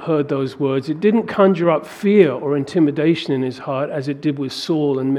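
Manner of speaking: ordinary delivery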